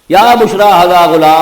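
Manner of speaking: calling out loud and slow from the well; a drawn-out, joyful cry across the camp; excited shout. Retold or imitated. The water-drawer shouts to his caravan, loud and slow. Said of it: "انہوں نے وہیں سے شور مچایا".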